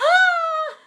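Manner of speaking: high, loud delighted gasp; sharp high gasp; excited gasp. Real, not acted. Excited sign-off.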